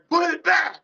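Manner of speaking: barked drill sergeant command; loud gung-ho soldier. Retold or imitated. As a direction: shout it like a Marine from across the bar. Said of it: "I had to use my Marine Corps voice".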